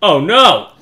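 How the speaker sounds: ordinary delivery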